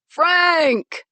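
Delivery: loud, high bellow across the yard. Acted. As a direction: call him out at full voice. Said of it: "Pete bellowed".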